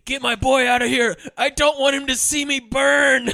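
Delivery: loud, high hammed-up yelling; mock desperate yell. Burning dad yelling to get his boy away, mock desperate yell. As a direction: yell it out, stretching the last words. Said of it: "he's yelling at Michelle Forbes".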